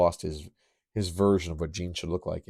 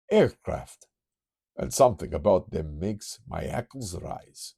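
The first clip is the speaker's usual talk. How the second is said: put-on Transylvanian accent. Kurt senses aircraft, light Transylvanian accent. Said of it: "I can't help but go Transylvanian".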